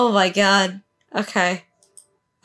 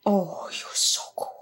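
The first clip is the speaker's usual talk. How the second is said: whispered, much softer; awed whisper; 4 semitones lower, 12 dB quieter than usual. Real, not acted. Fan whispers in awe at the hero, hushed. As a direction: breathe it out in awe.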